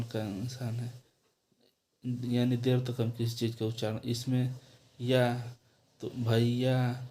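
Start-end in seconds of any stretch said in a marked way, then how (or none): 6.0-7.1 भैया said slowly with a long held आ; long vowel. Shown as a demonstration. Teacher showing the class a long आ in भैया. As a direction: drag out the आ in भैया. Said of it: "आ का उच्चारण देर तक".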